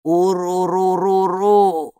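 long, loud slow creature howl; creature call. Acted. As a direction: draw out the rolling call like a wordless beast. Creature introduces itself by its only call.

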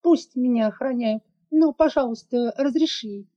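put-on girl pressing her father to agree; insisting little girl. A narrator's reading. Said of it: "уверенно настаивала девочка".

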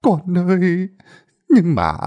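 put-on high, trembling plea of a starving old mother; whimpering old woman. A narrator's reading. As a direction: beg through a quivering mouth. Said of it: "bà cũng mếu máo mà nói".

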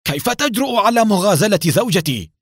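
loud, high-pitched outraged shout; feigned angry shout. A narrator's reading. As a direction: yell like a wronged husband. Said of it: "وهو يصيح متظاهرا بالغضب".

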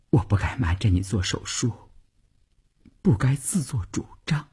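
meek, softly spoken confession; contrite wife. A narrator's reading. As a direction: apologise in a small, hushed voice. Tearful wife apologises to husband.

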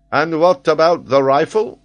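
high, loud, gloating question; victorious challenge, ringing out; triumphant. A narrator's reading. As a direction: crow it in triumph. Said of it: "asked the geographer triumphantly".

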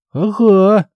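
put-on high, cheerful child's assent; smiling little girl. A narrator's reading. Little girl sent off to help bake, smiling high reply. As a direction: agree like an eager small girl, pitched high. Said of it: "улыбнулась девчушка".

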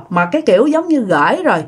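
ordinary talk, nothing marked

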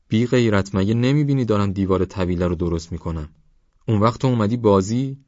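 fuming father berating his son; angry scolding. Acted. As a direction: tear into the boy for playing.

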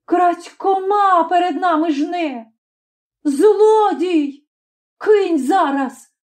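high, drawn-out angry accusation; slow, high-pitched outrage; furious cry. A narrator's reading. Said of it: "крикнула з люті".

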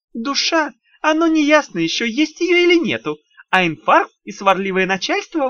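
high, wheedling little imp voice; sly, sugary demon lecturing; голос Тухламона. Acted. Тухламон рассуждает о людях. (вкрадчивый писклявый голосок беса)

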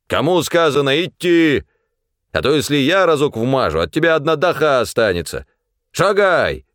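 high, forceful bullying order; threatening command. A narrator's reading. Fyodor grabbing the supply man, threatening command. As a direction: warn him, then order him to march.

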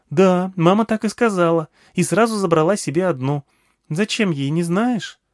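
put-on high, eager child voice; small girl eagerly addressing an old man; little girl. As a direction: pitch it up like a chatty little girl. Narrator voices little girl Nagma.

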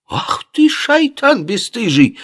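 very high, scandalised squawk from the camel owner; gasping outrage. A narrator's reading. Camel owner offended by the stranger's gestures, gasping in outrage. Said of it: "ахнул хозяин верблюдицы".

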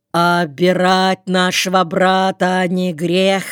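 plain reading voice

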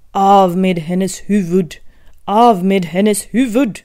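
loud, slow enraged royal order; an angry loud queen shouting her order; furious shout. Acted. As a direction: roar the sentence at her. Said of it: "blev ilsk och ropade".

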